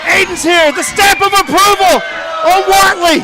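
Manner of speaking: high, loud ringside call; excited shout. Real, not acted. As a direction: yell the arrival over the crowd.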